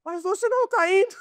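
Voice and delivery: high-pitched, incredulous retort; replaying his own stunned reaction; shocked disbelief. Missionary retelling his shocked reply to the bricklayer, high incredulous. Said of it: "chocado como americano".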